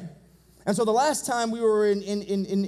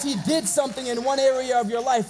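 full-force preaching; 3 semitones higher than usual. Real, not acted. Preacher assuring the church of an unchanging God, full-force preaching. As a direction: drive each phrase home to the congregation.

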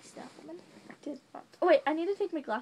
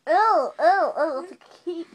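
loud disgust; 4 semitones higher, 9 dB louder than usual. Real, not acted. Disgusted at object in mouth.

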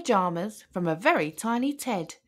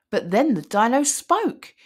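ordinary delivery, nothing marked

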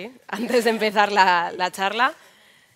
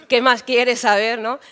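louder, drawn-out exasperated comeback; exasperated retort; 3 semitones higher, 8 dB louder, slower than usual. Acted. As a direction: push back at a nosy questioner, loud and slow. Acted reply to repeated origin questions.

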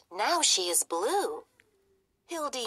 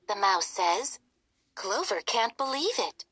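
usual speaking voice

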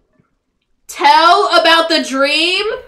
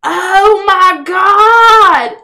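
stunned gasp; 6 semitones higher, 7 dB louder, much slower than usual. Real, not acted. Amazed reaction.

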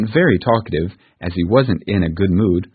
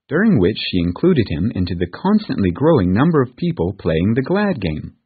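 ordinary delivery

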